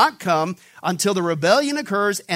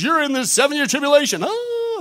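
higher, louder mock alarm rising to a squeal on oh; false teachers' alarm; 7 semitones higher, 4 dB louder than usual. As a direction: ham up the panicky false teacher. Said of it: "False teachers have come in and says".

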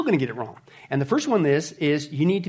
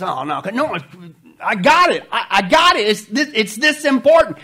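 impatient interrupter; 4 dB louder than usual. Acted.

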